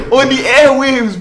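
high, loud, breaking into laughter; laughing disbelief. Real, not acted. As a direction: crack up while calling out the outrage. Reacting to joke on air.

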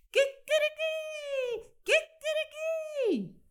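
very high, slow crowing rooster; long high cock-a-doodle-doo calls. Acted. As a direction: crow it out like a barnyard cockerel.

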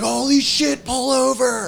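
high, loud yell of amazement; excited yell. Retold or imitated. Friend in the car spotting a moose, excited yell. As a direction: holler it out, pitch shooting way up.

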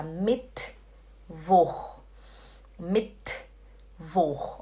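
"Mittwoch" slowly as Mit, pause, voch; clean German t. Shown as a demonstration. Teacher modelling it for learners, "Mittwoch" split after a bare t. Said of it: "Aí você fala".

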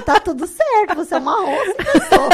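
loud, high, laughing as the husband; laughing husband's reassurance. Retold or imitated. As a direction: quote him through laughter. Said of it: "Aí meu marido".

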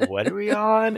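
squeaky high confusion; confused squeak. Retold or imitated. Retold confused quote.

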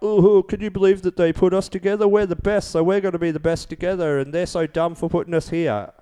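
high, smug mocking voice; mock the smug contestants.

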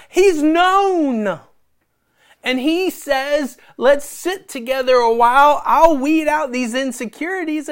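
high, loud, driving sermon voice; full-force preaching. Acted. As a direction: preach it out with rising fire.

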